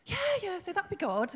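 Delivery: high, breezy imitation of Sarah; lightly voiced friend, casual; friend's reply.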